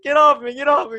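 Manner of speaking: very high, loud, squealing protest; mock panic shriek. Victim fights off the ghost, high loud squeal. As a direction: flail and squeal it, hammed-up.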